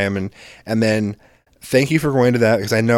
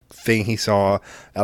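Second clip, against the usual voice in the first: ordinary delivery, nothing marked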